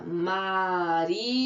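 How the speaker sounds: Marina drawn out slowly as Ma-ri-na; syllable by syllable. Shown as a demonstration. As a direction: stretch each syllable of Marina for counting. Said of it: "Quantas vezes?".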